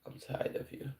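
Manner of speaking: whispered, very soft; whispered aside. Real, not acted. Speaker muttering to a disruptive listener, whisper. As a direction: breathe it under your breath.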